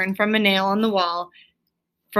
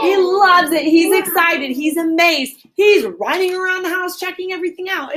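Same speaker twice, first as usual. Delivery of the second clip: excited; 9 semitones higher than usual. Real, not acted. Teacher excited about Rat.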